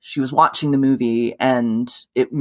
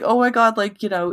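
higher gushing socialite voice; Paris Hilton impression; 9 semitones higher than usual. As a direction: gush like an excited celebrity. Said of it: "but she was like".

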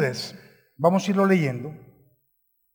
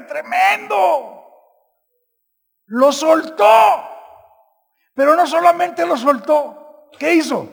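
high, loud shout of amazement; preaching shout; 6 semitones higher, 3 dB louder than usual. Real, not acted. Pastor marvels to the congregation, shouting. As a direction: cry it out to the church.

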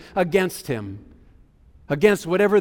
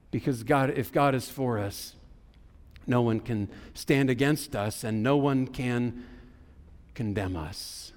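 hushed; 6 semitones lower, 8 dB quieter than usual. Real, not acted. Preacher drops to hushed emphasis.

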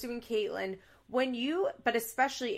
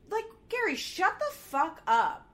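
mock exasperation; 7 semitones higher than usual. Exasperated rant at Gary.

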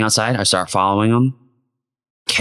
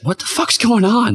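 high, startled cry; a server alarmed by the fight, pitched high; 12 semitones higher than usual. Retold or imitated. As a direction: jump up in pitch, caught off guard.

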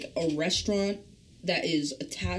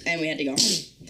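ordinary delivery

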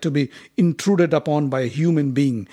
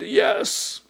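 yawning; 8 semitones higher than usual. A narrator's reading. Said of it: "Bhima yawned and said".

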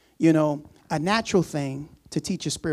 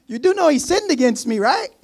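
higher, louder protest of a wronged man; a wronged disciple protesting to Jesus, higher and louder; indignant Peter; 6 semitones higher, 6 dB louder than usual. Pretend. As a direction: push back, aggrieved and incredulous. Peter voiced complaining to Jesus.

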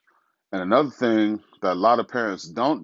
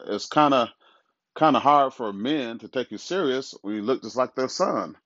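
ordinary delivery

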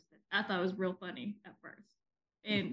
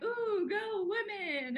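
higher, slow hammed-up cheer; mock cheer; 10 semitones higher, much slower than usual. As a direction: cheer it on in a playful, overdone way. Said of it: "there are days like".

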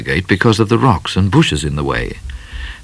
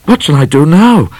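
flustered question; 10 semitones higher, 6 dB louder than usual. Acted. Midnight panicking at lady's arrival.

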